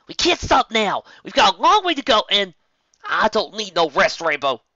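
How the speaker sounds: high, loud, pushy tomboy pony; eager Rainbow. Acted. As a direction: push hard, refuse to stop.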